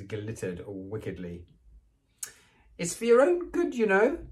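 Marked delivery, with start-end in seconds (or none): 2.8-4.3 saintly voice. Acted. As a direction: fake piety over sly glee. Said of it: "said Peeves in a saintly voice".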